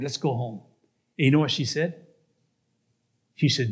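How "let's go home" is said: low, soft, weary suggestion; tired request. Acted. Tired man to his girlfriend, low weary request. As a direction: ask quietly, worn out. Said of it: "I'm tired".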